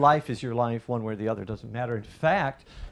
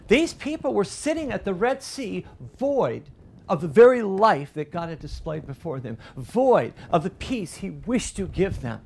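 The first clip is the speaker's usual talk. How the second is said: higher, louder fervent preaching; full-force preaching; 8 semitones higher, 5 dB louder than usual. Acted. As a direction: preach it out with rising fire.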